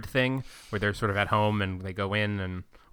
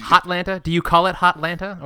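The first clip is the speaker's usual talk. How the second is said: mock outsider nickname; 6 semitones higher, 6 dB louder than usual. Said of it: "what outside people do".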